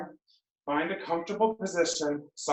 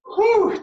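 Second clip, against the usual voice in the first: high relieved whew; 9 dB louder than usual. Real, not acted. Relief after finishing the set.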